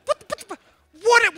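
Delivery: normal talking voice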